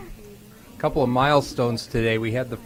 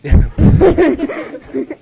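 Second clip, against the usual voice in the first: bursting high laugh; real laugh; 14 dB louder than usual. Real, not acted. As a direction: laugh out loud, amused. Host laughs at kid's answer.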